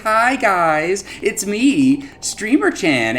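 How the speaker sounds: put-on high, bright cutesy anime girl greeting; cute anime streamer. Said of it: "talking like a cutesy anime girl".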